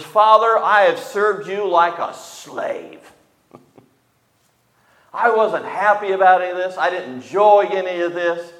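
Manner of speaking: elder son telling his father he slaved, resentful. Acted. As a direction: spit out the grievance like a bitter son. Elder son voiced complaining to father.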